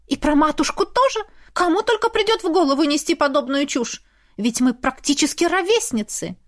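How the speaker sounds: a haughty woman protesting; indignant woman. A narrator's reading. Lizaveta's voice in the narration.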